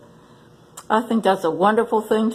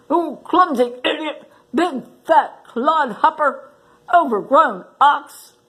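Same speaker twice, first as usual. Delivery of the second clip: higher, sputtering angry critter voice; furious porcupine; 7 semitones higher than usual. Acted. Porcupine raging at the rhino, high and angry. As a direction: rant like a small furious porcupine. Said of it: "his quills twitching in fury".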